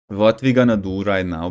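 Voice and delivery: normal talking voice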